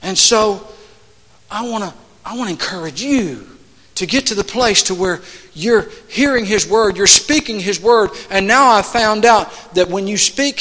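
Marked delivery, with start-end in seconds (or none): none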